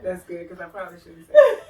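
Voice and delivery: laughing aside. Real, not acted. Laughing at her own remark.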